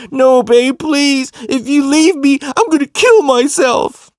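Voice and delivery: high, loud, hammed-up begging; desperate pleading. Cousin begging girlfriends not to leave, melodramatic pleading. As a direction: imitate a cousin's melodramatic, wailed plea. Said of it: "he would really sell this shit".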